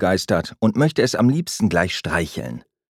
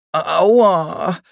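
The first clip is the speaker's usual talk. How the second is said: high, loud yelp of pain after a fall; ouch of pain; 10 semitones higher, 6 dB louder than usual. A narrator's reading. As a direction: cry out as the bed collapses. Luis falls with the bed.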